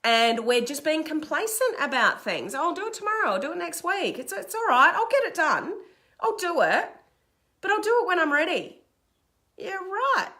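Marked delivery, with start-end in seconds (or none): 2.5-8.8 high, breezy put-off voice; mock complacency. Mimicking complacent procrastinator. 9.6-10.3 high, scoffing dismissal; sarcastic disbelief. Acted. Scoffing at the acted excuses.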